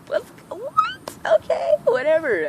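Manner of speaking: squeaky, very high what?; baffled that nothing happened; mock high-pitched confusion, then shrugging it off; stunned disbelief. Bullies walk past without fighting.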